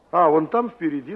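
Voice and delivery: ordinary delivery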